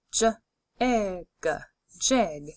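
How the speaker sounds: J, ig, jig: each part slow and separate, then the whole word; segmented then blended. Shown as a demonstration. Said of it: "Say the sounds with me and blend together".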